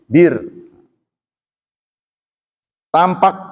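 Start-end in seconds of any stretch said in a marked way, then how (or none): 0.1-0.4 "Bir" with a thin, light r. Shown as a demonstration. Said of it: "kalau kasrah tipis". ("Bir" dibaca tipis)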